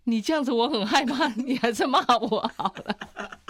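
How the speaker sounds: mock scared husband. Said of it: "他就说".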